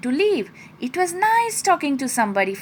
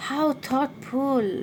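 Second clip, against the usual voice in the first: quiet, slow words to himself; soft to himself; 5 dB quieter, slower than usual. Acted. Squirrel touched by his first present, quiet and slow to himself. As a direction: savour the gift quietly, half to himself. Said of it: "said to himself".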